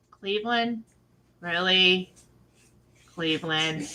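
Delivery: low, quiet deadpan questioning; unimpressed disbelief. Pretend. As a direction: drop it down, dismayed, with pauses between. Her own contrasting unimpressed reaction.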